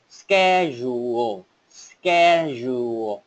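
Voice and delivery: schedule said slowly, then sped up; slow practice take. Shown as a demonstration. Teacher's slow first practice take of schedule. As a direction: start schedule slow and split. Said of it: "cái cách luyện tập chuẩn đó là, ban đầu là".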